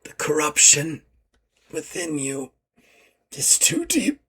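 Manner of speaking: dying words. Acted. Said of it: "and he goes".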